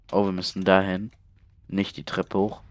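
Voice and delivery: ordinary talk, nothing marked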